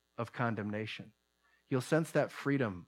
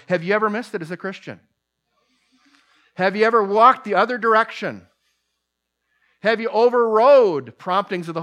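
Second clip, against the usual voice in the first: loud, higher, rising challenge; preaching at full force; 8 semitones higher, 12 dB louder than usual. Acted. Preacher challenging the congregation about their stubbornness, loud and pressing. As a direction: drive each question home to the room.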